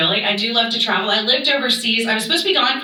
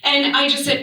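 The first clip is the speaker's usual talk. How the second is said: ordinary talk, nothing marked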